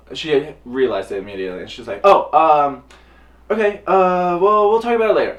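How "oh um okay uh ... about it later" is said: high, loud, rushed flustered brush-off; mother hurriedly ending the call, high and flustered; flustered mom. Retold or imitated. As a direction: mom suddenly gets it and wants off the phone.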